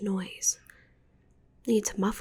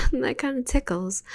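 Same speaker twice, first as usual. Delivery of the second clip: giggling; 4 semitones higher, 8 dB louder than usual. Acted. Listener climbing her body.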